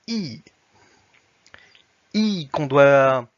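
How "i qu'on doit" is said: the I held long and loud, between ou and i; vowel demo. Shown as a demonstration. Teacher demonstrates the Russian vowel, held loud. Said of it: "entre le ou et le i".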